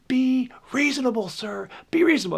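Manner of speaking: high-pitched imitation of a woman screaming; mock scream. Woman hiker pleading with a runner to stop, mock screaming. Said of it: "she starts screaming".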